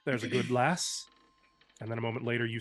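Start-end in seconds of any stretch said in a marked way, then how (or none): 0.1-1.1 librarian. Acted. Librarian warning the maid.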